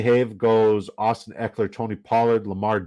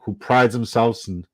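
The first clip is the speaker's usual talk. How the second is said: usual speaking voice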